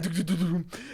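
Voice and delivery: quick, soft "duk-duk-duk" thudding; imitated tumbling thuds. Teller acting out his friend's fall with the couch, imitated thuds. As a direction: mimic a body bumping down steps.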